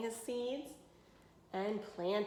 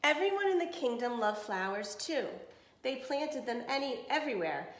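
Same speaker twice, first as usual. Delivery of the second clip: clear, even storybook reading; reading to children; 2 semitones higher than usual. Acted. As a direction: read it to a class of kids. Reads picture book to class.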